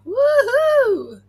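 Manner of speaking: high whooping cheer; excited cheer. Real, not acted. Cheering at swirl.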